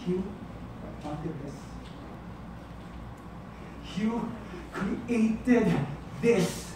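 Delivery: soft words with long pauses; quiet accusation. Acted. Jilted partner blaming the lover, quiet and halting. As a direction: lay blame quietly, word by word.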